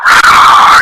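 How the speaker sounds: loud voiceless crowd-roar noise; imitated crowd roar. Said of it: "you could hear the roar".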